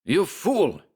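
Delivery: high, angry German professor; furious German scolding. Acted. Schmersholt berates the narrator, furious German accent. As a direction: spit it out in rage. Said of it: "bombarded me with German oaths".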